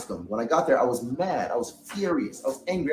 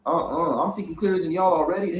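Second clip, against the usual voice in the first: much higher, heated exasperated rant; retold outraged rant; 11 semitones higher than usual. Retelling his outburst at the group on arrival: outraged rant. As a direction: vent the betrayal pitched way up. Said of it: "I was mad".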